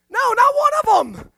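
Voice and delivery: high and loud, driving the point home; emphatic no. Real, not acted. Preacher answering his question to the congregation, loud and emphatic. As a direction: shout the answer to your own question.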